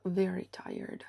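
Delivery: ordinary talk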